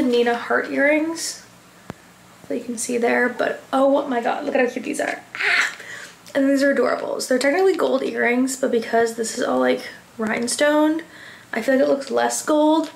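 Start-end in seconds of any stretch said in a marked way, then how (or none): none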